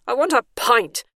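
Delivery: high, loud yelled demand. A narrator's reading. Bernice demands a pint instead of a nap, yelling. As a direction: yell it out defiantly. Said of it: "she yelled".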